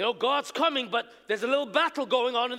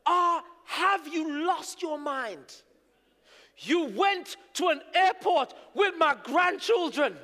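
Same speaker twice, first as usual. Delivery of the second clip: higher, slow, outraged mother; scolding Jamaican mother; 5 semitones higher, slower than usual. Retold or imitated. Mother phoning her son after the airport, scolding and higher. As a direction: scold like a shocked mum. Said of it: "My mother phoned me and said".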